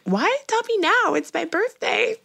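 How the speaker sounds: high-pitched plaintive complaint; mock protest. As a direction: act out a wailing objection, voice way up. Re-enacts protest at partner's confession.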